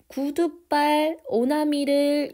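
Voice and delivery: 구두발 and 오나미 stretched out slowly, high and loud; emphatic memory cue. Shown as a demonstration. Recap of key memory words.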